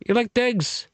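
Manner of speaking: put-on high, thick Irish traveller accent, mumbled; mock Irish traveller accent. Quoting Snatch to a drinking buddy in a mock Irish traveller accent. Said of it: "go into that language style".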